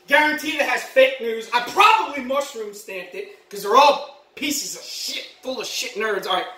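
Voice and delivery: usual speaking voice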